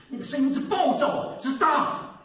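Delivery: 提高嗓门示范暴躁的语气; 冲着丈夫嚷嚷那样大声; 暴躁大声. Shown as a demonstration. (示范暴躁的大嗓门)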